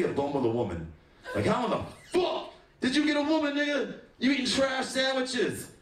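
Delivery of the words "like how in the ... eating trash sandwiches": high-pitched outraged disbelief; high indignant rant. Acted.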